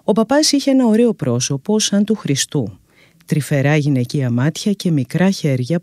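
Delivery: ordinary delivery, nothing marked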